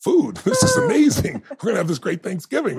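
put-on father brings no food, the kid squeals with delight; kid's excitement. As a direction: relive a hungry kid seeing the turkey, squealing. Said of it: "I was just a kid".

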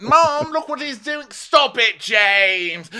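high, loud yell for Mum; hammed-up tattletale outburst; mock whiny tattling. Acted whining complaint to mother.

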